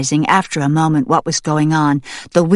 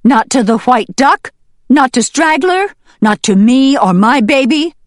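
raised, emphatic reproach from a mother hen; accusing hen; 6 semitones higher, 7 dB louder than usual. Acted. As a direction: press each charge louder and higher.

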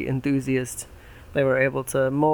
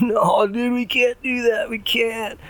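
frat bro; 9 semitones higher, 3 dB louder, slower than usual. Acted. Pig one voiced in story.

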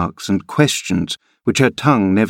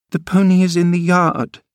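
put-on high girlish voice, playful and teasing; a spoiled girl showing off her adventure; lively young girl; 9 semitones higher than usual. A narrator's reading. Girl Cathy replies.

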